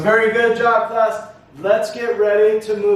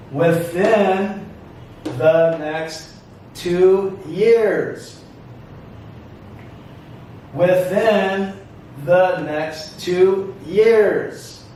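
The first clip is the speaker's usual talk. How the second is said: very slow, spaced-out phrase; careful classroom model for repetition; repeat-after-me model; much slower than usual. Shown as a demonstration. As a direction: pause after "Within", stretch "two years". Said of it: "Repeat after me".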